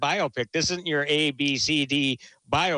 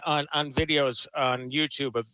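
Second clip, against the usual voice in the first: ordinary talk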